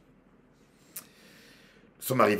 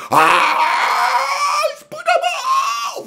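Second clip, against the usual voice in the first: very loud, high screamed prayer; imitating screaming worshippers; mock howling; 11 dB louder, slower than usual. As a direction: howl it in exaggerated fervour. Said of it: "les chrétiens d'aujourd'hui vont hurler".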